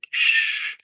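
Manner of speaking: a sharp unvoiced shush. Father shushing his kids before surprising wife, whispered shush. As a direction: hush the kids at once. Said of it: "but he's like".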